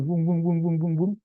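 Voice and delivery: lightly droning swarm of bees; mock hum of a chattering crowd; imitated bee buzz. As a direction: imitate an audience buzzing like bees. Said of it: "audien itu sedang kayak tawon".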